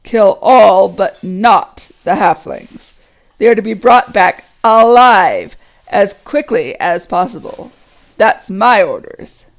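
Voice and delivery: slow, loud orc captain's growl; deep growl. Acted. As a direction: lay down the law, slow and heavy. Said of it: "said a third voice in a deep growl".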